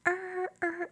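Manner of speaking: high squealing car noise; high mock engine rev. Driving her Jeep across the parking lot, high revving noise. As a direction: imitate tires screeching across the lot. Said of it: "And I'm like".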